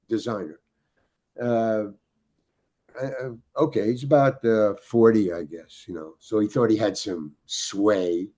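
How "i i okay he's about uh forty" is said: usual speaking voice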